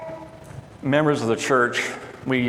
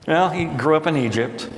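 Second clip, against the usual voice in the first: usual speaking voice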